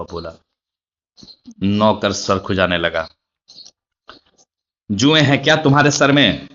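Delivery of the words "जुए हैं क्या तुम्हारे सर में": higher and louder scolding question. A narrator's reading. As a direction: snap at the servant, scolding him. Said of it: "Imran ने डांट कर पूछा". (डांटते हुए ऊँची आवाज़ में)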